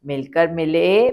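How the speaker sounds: normal talking voice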